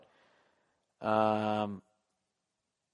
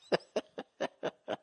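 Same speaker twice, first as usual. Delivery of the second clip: laugh; 4 dB quieter than usual. Real, not acted. Laughs at himself.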